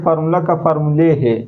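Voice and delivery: ordinary delivery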